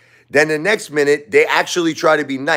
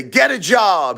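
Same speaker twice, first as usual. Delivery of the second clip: higher, snide jab; sudden insult; 8 semitones higher, slower than usual. Retold or imitated. Brooklyn John turning on the father, with a higher snide jab. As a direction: snap out the insult. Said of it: "he'll say".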